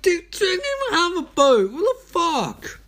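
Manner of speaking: high, loud disbelief; shocked outburst at a friend's nonsense; incredulous outburst. Retold or imitated. Retelling his reply to her text, incredulous and high. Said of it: "And I'm like".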